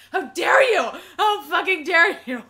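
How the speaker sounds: loud, high indignant scolding; heated mock confrontation, nearly laughing; so angry. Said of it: "it made me so angry".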